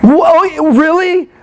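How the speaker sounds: startled mock outrage at being told to calm down; mock indignant. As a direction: act put-out and affronted. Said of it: "it makes me more, like".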